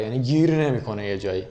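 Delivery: ordinary talk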